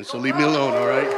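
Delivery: loudly mock-snapping at the crowd; joking angry outburst; mock-angry shout. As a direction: playfully yell leave me alone. Joking mad Cowboys fan.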